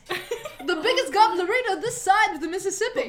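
hammy bar order. Acted.

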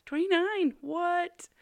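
high, loud incredulous outburst; amazed disbelief. Real, not acted. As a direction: squeal it in astonishment. Amazed at download count.

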